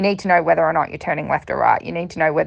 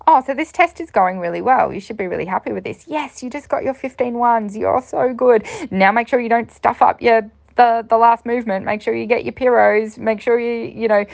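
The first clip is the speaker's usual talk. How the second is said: higher, upbeat commentator chatter; 5 semitones higher than usual. Retold or imitated. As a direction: narrate your own ride like a commentator. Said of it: "my commentator voice".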